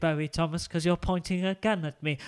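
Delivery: ordinary talk, nothing marked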